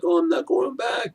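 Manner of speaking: high, whiny mock complaint; hammed-up whining impression; whiny Daisy. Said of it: "Daisy's turn to be whiny".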